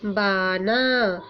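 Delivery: बाना drawn out very slowly; teacher reading बाना slowly; slow modelled word. Shown as a demonstration. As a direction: stretch बाना for the class to hear. Teacher reads new word slowly.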